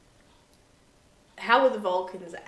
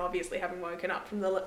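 ordinary delivery

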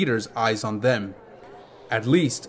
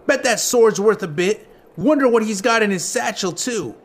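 put-on higher-pitched street kid; greedy gang kid; 8 semitones higher than usual. A narrator's reading. Gang kid eyes Link's sword and satchel, greedy. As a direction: size up the loot in a kid's voice.

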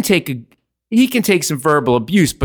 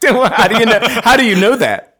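higher-pitched, laughing question; real amused disbelief; 8 semitones higher, 4 dB louder than usual. Real, not acted. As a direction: ask through a burst of laughter. Cracking up at revelation.

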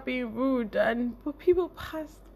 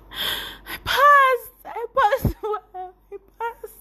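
high, loud, tearful cry of joy; ecstatic; 10 semitones higher, 7 dB louder, much slower than usual. Retold or imitated. Phoning friends, high tearful ecstatic cry. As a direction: shout the news through tears. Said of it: "I called lots of people".

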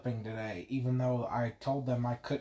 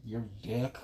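ordinary talk

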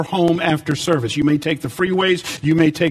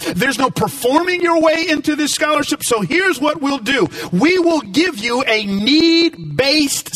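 raised, ringing preacher voice; full-force preaching; 9 semitones higher than usual. Acted. Preacher telling the church about a scholarship, at full force. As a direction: build it up like a revival sermon.

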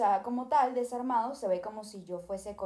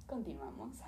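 whisper; 13 dB quieter than usual. Real, not acted. Aside to viewers.

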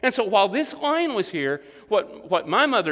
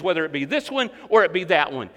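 normal talking voice

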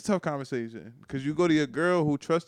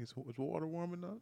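put-on coaxing boyfriend; 18 dB quieter than usual. Boyfriend checking bath in skit.

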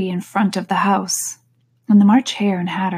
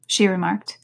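ordinary delivery, nothing marked

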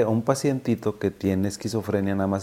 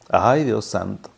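normal talking voice

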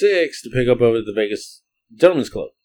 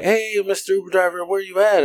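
imitated calling girls; 7 semitones higher, 4 dB quieter than usual. Acts out girls calling for driver.

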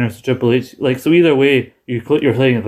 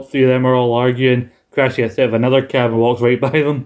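ordinary talk, nothing marked

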